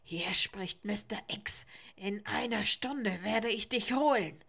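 raspy, breathy croak of a menacing stranger. A narrator's reading. Mister X threatening the boy by phone, menacing croak. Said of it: "krächzende Stimme".

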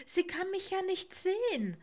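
high-pitched, helpless protest; desperate. Acted. Mama Owl explains her problem to the raven, desperate. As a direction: lift the voice in helpless despair. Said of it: "fragte Mama Eule verzweifelt".

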